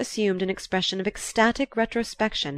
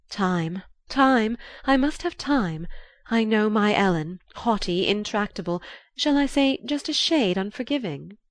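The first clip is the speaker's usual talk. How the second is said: higher-pitched, theatrical society lady; dramatic Marchioness; 4 semitones higher than usual. Acted. Marchioness pleading for time with her niece, dramatic. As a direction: lift the pitch and plead for patience.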